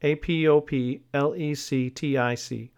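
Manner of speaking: each letter separate, A P O P L E C T I C; spelled out. Shown as a demonstration.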